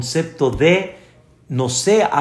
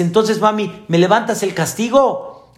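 put-on higher, pleading little boy; higher child voice asking mom to lift the punishment; 6 semitones higher than usual. Child asks mother in joke.

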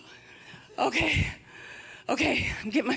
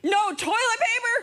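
laughing mock disbelief; 9 semitones higher than usual.

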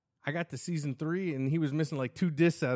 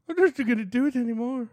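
ordinary delivery

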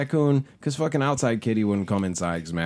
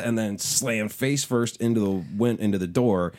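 usual speaking voice

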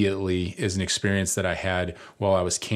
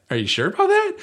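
high, louder sceptical question; retold disbelief, pitched way up; mock incredulity; 5 dB louder, faster than usual. Retold doubting reply to friend.

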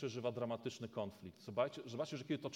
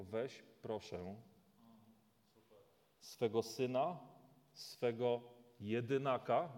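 gentle request; slower than usual. Shown as a demonstration. Preacher showing God asks, not commands: gentle, slow plea. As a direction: ask it slowly and gently, "weź, proszę" as a plea. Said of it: "Nie przychodzi z nakazem, żądaniem, tylko".

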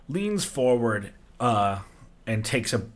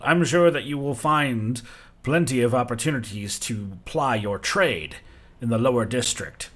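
regal desert giant; 3 dB louder than usual. Acted. The giant king greeting wanderers on his dunes, grand and amused. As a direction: savour every word like a king granting an audience.

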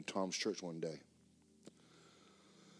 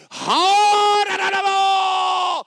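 shouted tongues, much higher, slow; praying in tongues; 18 dB louder, much slower than usual. Retold or imitated. Preacher shouting in tongues at the demon, very loud and high. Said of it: "And I am like".

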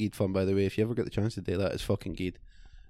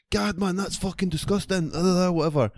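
pitched-up squeal of disgust, hammed; higher put-on voice, retold outrage; mock disgusted cadet; 9 semitones higher than usual. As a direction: imitate the cadet recoiling in disgust. Quoting the cadet's disgusted reaction.

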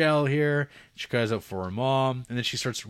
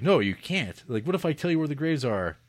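lightly acted, higher-pitched objection; mock pleading protest; 6 semitones higher, 4 dB louder than usual. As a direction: object quickly, imitating a protesting girl. Girl's protest acted in retelling.

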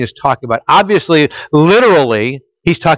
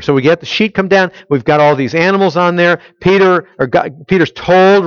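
ordinary talk, nothing marked

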